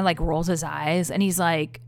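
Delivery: ordinary talk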